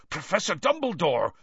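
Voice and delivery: high-pitched startled greeting. A narrator's reading. Hagrid arriving to find Dumbledore in the forest, startled.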